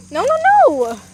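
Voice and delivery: loud high no no no; startled loud protest; alarmed shout. Real, not acted. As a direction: cry out in alarm. Hen ruining nest.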